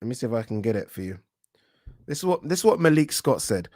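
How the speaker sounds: usual speaking voice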